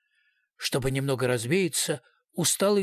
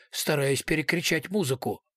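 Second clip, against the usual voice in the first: ordinary delivery, nothing marked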